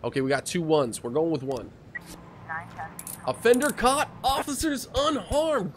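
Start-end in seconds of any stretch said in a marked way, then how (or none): 3.3-5.7 high, slow victory call; triumphant announcement. Real, not acted. Streamer reads the win aloud, high and slow. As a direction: crow the win.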